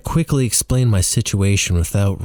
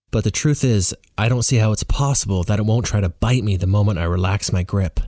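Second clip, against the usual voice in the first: plain reading voice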